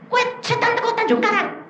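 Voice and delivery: very high, incredulous question; retold squeal of surprise; acted disbelief. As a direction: echo it back high in mock astonishment. Incredulous at demon's stated origin.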